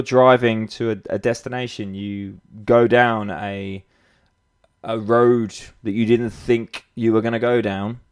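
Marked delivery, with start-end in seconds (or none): none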